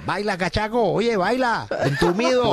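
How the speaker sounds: high, teasing imitation of hecklers; mock carnival shouting. Carnival crowd goading a stiff dancer, high mock yell. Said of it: "en el carnaval me gritan".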